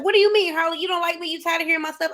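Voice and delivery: mock wounded, high-pitched questions; imitating a lonely client taking offence; hurt client voice. Coach mimics a needy client asking if she's tired of them. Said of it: "people who suffer from loneliness are immediately like".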